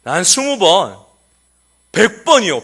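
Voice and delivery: put-on one-up the other kids; a boy topping his classmates' answers; competing child. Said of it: "경쟁하듯이 다른 아이가 이야기하시고".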